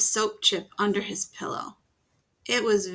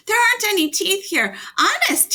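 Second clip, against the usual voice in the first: high, loud, fast pleading; pleading boy; 11 semitones higher, 8 dB louder than usual. A narrator's reading. Norman pleading with the monsters, high, loud and fast. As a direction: rush it out to convince them.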